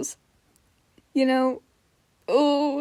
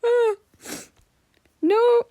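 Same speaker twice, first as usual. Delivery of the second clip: high squeaky voice; 12 semitones higher than usual. Shown as a demonstration. Keeps trying Hootie's high voice.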